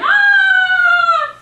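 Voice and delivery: very high, very loud shriek; Glinda screaming. Acted. Glinda bursts in with the gingerbread man, screaming. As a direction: let out a piercing scream of horror. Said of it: "She's screaming".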